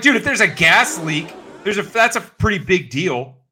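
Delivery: exasperated rant. Retold or imitated. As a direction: hammer home that it matters. Lecturing maintenance guy.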